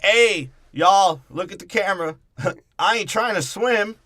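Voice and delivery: high, loud put-on panicked voice; mock Tyrese. Friend voicing Tyrese stuck in freezing water, high and loud. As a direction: yelp it like he's sinking in ice. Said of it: "'cause Tyrese is like".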